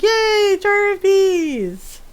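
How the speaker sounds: high, drawn-out cheer. Pretend. Player cheering a game attack, gleeful. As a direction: stretch out a loud, high hooray.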